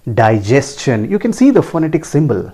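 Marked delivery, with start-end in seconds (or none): 0.1-1.1 Digestion slowly as dai-JES-chun; careful S-CH in Digestion; correct pronunciation. Shown as a demonstration. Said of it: "It is pronounced as". (Digestion का सही उच्चारण, धीरे से)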